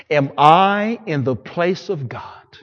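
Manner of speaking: slow, measured question with the weight on I. Acted. Joseph gently asking his brothers, slow and measured. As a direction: let "Am I in the place of God?" hang slowly, stressing I.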